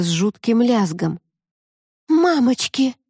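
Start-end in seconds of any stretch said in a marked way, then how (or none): 2.1-2.9 high, startled exclamation; frightened cry. A narrator's reading. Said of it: "воскликнула Лика".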